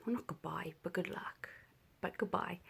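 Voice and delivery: hushed, barely voiced correction; whispered aside. Real, not acted. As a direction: drop to a soft whisper. Aside correcting herself softly.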